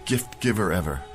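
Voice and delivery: ordinary talk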